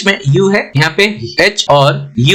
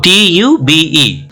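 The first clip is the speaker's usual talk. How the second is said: slow spelling; much slower than usual. Shown as a demonstration. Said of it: "spelling out letters".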